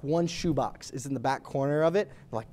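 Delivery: ordinary talk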